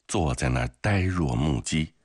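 plain reading voice

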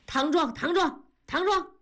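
refusing to back down, topping her; husband matching his wife shout for shout; stubborn repeated shout. A narrator's reading. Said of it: "不甘示弱，连声地说".

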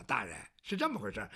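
a nervous servant rushing his excuse; fawning servant. Acted. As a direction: mumble it quickly to cover up.

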